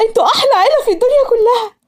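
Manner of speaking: high-pitched happy squeal; joyful scream. A narrator's reading. Daughter thanks her parents for approving her marriage, screaming with joy. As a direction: cry it out high, overjoyed. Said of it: "صرخت بسعادة".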